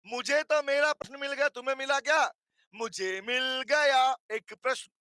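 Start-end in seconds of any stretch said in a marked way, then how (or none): none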